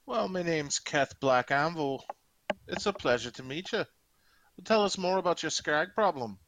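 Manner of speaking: courteous orc with a put-on Scottish burr; horrible Scottish accent. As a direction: introduce yourself in mock Scottish. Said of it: "Competing with my horrible Scottish accent".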